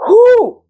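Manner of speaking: sharp high whoop of excitement. Real, not acted. After praising her sister's roots, a high whoop.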